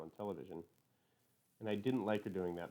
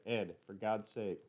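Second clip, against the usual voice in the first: slow, exasperated half shout; a mother's narrated plea, partly shouted; restrained shout; 4 dB louder, much slower than usual. A narrator's reading. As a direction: drag out the protest, voice mildly raised. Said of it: "Mother shouted".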